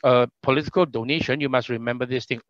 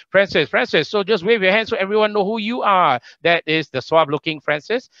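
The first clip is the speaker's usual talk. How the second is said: raised, animated introduction voice; MC announcer; 5 semitones higher, 3 dB louder than usual. Real, not acted. Host presenting panelists to everyone, lively MC delivery. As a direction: present each guest to the audience with lift.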